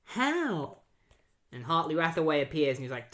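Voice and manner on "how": much higher, disbelieving cry of the name; shocked surprise. Retold or imitated. Wally seeing Piper appear, shocked high surprise. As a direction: sound floored to see him there. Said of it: "Wally's like".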